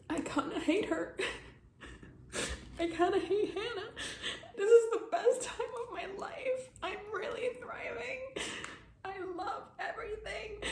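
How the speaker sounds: high, laughing and sobbing; mock cheer through tears; tearful ironic high voice; sarcastic crying. Real, not acted.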